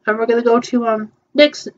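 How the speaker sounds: ordinary talk, nothing marked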